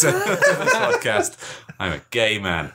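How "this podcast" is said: high squeak through laughter; laughing. Real, not acted. Laughing mid-sentence.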